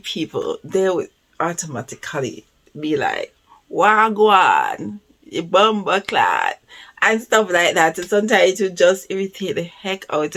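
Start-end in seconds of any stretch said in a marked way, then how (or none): 3.7-6.6 loud mock patois greeting; louder, higher Jamaican slang call. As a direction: imitate tourists faking a Jamaican accent. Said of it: "faking the accent".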